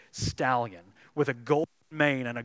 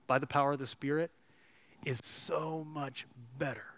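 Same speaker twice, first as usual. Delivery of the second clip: normal talking voice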